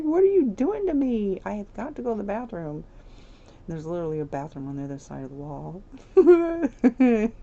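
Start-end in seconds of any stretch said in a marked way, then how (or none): 0.0-2.8 desperate Sim voice. Acted. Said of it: "She's like".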